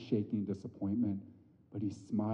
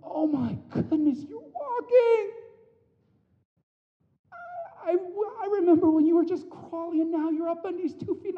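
put-on very high, gushing baby-talk voice; doting dad; 3 dB louder than usual. Father cheering his daughter's first steps, high and doting. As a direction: coo with delight at a toddler. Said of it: "I'm like".

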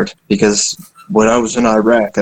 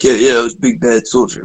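tough soldier bragging. Retold or imitated. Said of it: "I'm not gonna fucking beef it up and be like".

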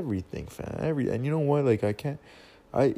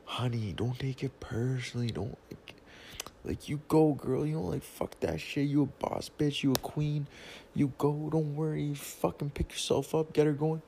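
higher, softer cheerleading friend; hype friend; 5 semitones higher, 3 dB quieter than usual. Retold or imitated. Comforting the moping girl by text, a higher, softer hype voice. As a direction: pump her up sweetly, a bit higher. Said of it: "at first it was like".

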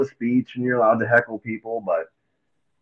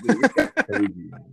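1 amazed aside; 3 semitones lower than usual. Retold or imitated. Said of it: "I'm just like".

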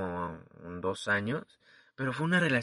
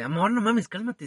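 higher, louder plea to calm down; exasperated plea; 9 semitones higher, 6 dB louder than usual. Retold or imitated. Boyfriend begging his angry girlfriend, exasperated and louder. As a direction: beg her to calm down, half laughing. Retold pleading in a fight.

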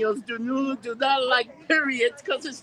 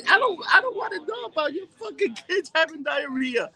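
raised, higher shouting rant; angry rant; 6 semitones higher than usual. Real, not acted. Ranting at group chat members, fed-up shout.

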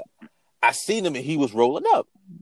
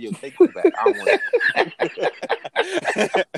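laughing through a mock panicked refusal; cracking up as he pushes it away; 12 semitones higher, slower than usual. Retelling his first hit of dust to his cohost, laughing.

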